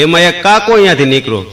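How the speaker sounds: usual speaking voice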